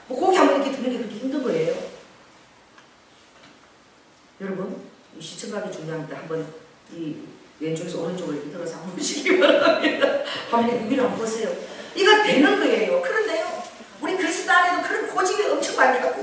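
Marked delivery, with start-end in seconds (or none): none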